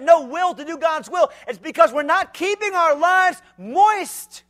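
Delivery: high, loud, shouted rebuke; preaching at full force. Acted. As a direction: shake the youth awake. Pressing questions.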